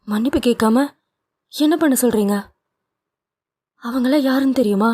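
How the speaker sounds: a wife scolding her husband at her father's party; Nithya rebuking. A narrator's reading.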